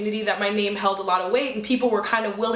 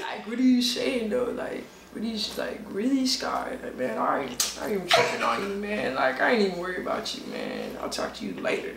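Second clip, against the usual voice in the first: Jerron impersonation; 5 dB quieter than usual. Acted. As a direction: mumble it like a guy shrugging someone off. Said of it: "what's your Jerron impersonation".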